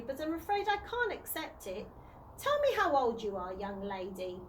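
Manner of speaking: put-on a kindly old gentleman turning down a gift; posh old tramp. A narrator's reading. Old tramp to young girl, refusing her money kindly, posh old voice.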